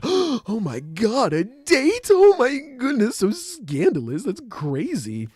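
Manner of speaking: very high, slow, hammed-up shock; mock scandalized gasping. Onlookers reacting to news of a date, mock scandalized. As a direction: draw it out like a scandalized gossip, far up in pitch.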